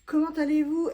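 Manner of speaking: bonjour lancé haut et fort; salut enjoué. Real, not acted. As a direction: saluer la caméra avec entrain. (salut joyeux et appuyé)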